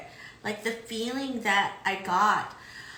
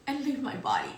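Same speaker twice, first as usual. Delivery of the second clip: usual speaking voice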